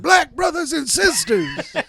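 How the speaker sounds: high, slow, booming mock preacher; hammed-up preacher greeting. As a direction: play a stagey preacher to the crowd. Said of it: "so I came out like this".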